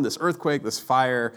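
normal talking voice

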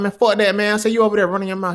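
put-on a teen talking back hard; defiant shout. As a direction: teenager cussing at big brother, defiant. Retold fight with older brother.

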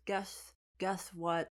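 slow, halting read-out; drawn-out, tentative reading aloud; reading piece by piece. Retold or imitated. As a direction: repeat the first word while deciphering. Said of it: "scratches the top and says".

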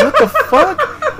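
high, slow incredulous exclamation; incredulous what the fuck. Retold or imitated. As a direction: throw it up high in disbelief. Exasperated quote of his own reaction.

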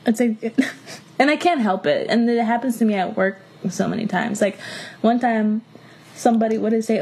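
usual speaking voice

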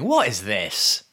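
imitating bin men. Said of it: "Much like the bin men saying".

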